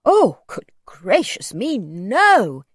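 slow, emphatic, flustered denial; shocked cry. A narrator's reading. Old man denies asking for money, shocked. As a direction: protest as if aghast at the idea. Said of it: "he cried".

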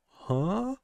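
high, soft puzzled huh; lost and puzzled over the plot; confused huh. Retold or imitated. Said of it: "you start to go".

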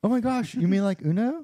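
high, mock-excited outsider voice; clueless normal person. Joining in the mockery of casual players, clueless normal person. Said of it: "the normal people are gonna be like".

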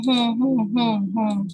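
normal talking voice

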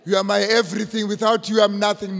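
mock an overblown love vow, drawn along in one breath; mock besotted lover. Preacher mimicking a lover who cannot live without the partner, mock besotted.

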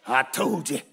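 low, soft told-you-so; wife's told-you-so. Retold or imitated. Miss Hogan to David, low and knowing.

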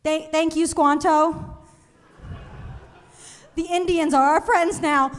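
lightly voiced kindergartner reciting stiffly; a hint of a child reading play lines; kid in school play.

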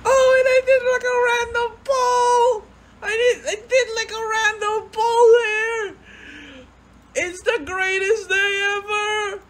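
very high, singsong squealing joy; overjoyed squeal. Real, not acted. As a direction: sing out the happy news. Overjoyed over random pull.

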